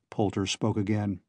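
usual speaking voice

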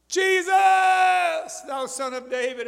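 high, slow desperate shout; crying out. Acted. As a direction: call out across a crowd to be heard. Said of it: "He cried out".

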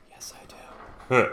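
breathy eager whisper, slow. Retold or imitated. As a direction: whisper it like a secret deal. Eager yes to bottle offer.

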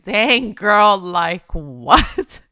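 a hint of sassy disbelief; mock astonishment. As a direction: act floored by someone's story. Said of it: "you'll be like".

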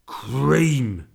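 loud, disgusted cabbie; bitter. A narrator's reading. Taxi driver finding mess on himself, bitter. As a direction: spit the word out bitterly. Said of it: "he said bitterly".

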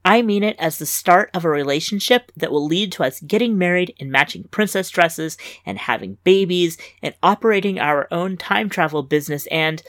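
usual speaking voice

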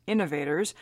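normal talking voice

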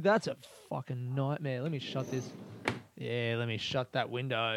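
ordinary delivery

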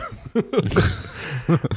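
real burst of laughter; high laugh breaking out. Real, not acted. Laughing after admitting to co-host being livid, real laugh.

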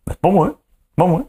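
high-pitched protest; mock innocent denial. Accused partner denies drinking the milk, high mock protest.

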